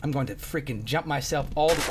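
ordinary talk, nothing marked

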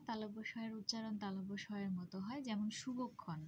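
normal talking voice

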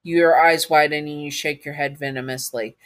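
plain reading voice

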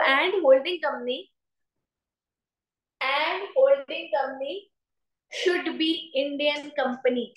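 very slow, stretched words with repeats; slow dictation. Real, not acted. Teacher dictating after telling students to write, slow dictation. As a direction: dictate at writing speed.

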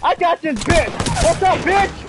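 real high-pitched gamer yelling; loud, excited combat shouting; shouted trash talk. Real, not acted. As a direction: yell taunts at an enemy mid-fight. Trash-talking enemies during game fight.

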